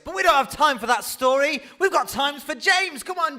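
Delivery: loud, high-pitched MC introduction; open mic host. Real, not acted. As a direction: call the next act up to the mic. MC introduces next open mic act.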